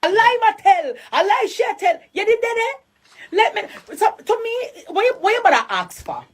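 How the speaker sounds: outraged disbelief in Jamaican Patois; incredulous outrage. Real, not acted. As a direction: scoff at the lie in disbelief. Incredulous rant about the officer.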